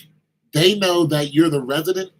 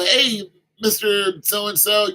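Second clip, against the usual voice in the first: calling out; 5 semitones higher, 5 dB louder, slower than usual. Pretend. Teenager calling out to neighbor.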